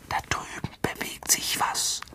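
hushed, voiceless whisper. A narrator's reading. Harry to Ron about movement in the dark, whispered. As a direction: whisper it very softly. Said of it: "flüsterte Harry".

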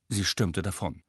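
usual speaking voice

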